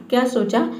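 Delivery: usual speaking voice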